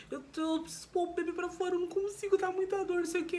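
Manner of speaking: high, urgent pleading; patient begging the nurse to help her; distressed. Retold or imitated. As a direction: rush it out, panicked and hurting. Telling nurse about her pain.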